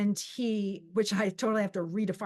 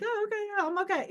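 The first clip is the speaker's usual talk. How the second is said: high, small, polite brush-off; put-on high voice insisting all is fine; falsely okay; 11 semitones higher, faster than usual. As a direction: imitate a chirpy pretence of coping. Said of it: "I'm normally not like that".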